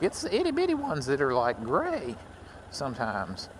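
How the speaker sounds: squeaky high "itty bitty", then settling back down; musing to himself. Retold or imitated. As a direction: jump way up in pitch on the tiny snakes. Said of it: "I was like".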